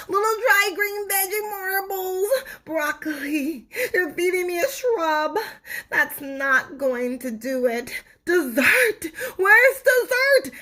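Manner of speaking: high, whiny, near-crying tooth begging; whining tooth. Acted. Tooth whines at Stuart for dessert, high and tearful. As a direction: beg like a spoiled little tooth.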